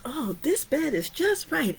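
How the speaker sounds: put-on high, pleased little girl voice; Goldilocks pleased. Storyteller voicing Goldilocks finding perfect porridge, high and pleased. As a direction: savor it like a happy child.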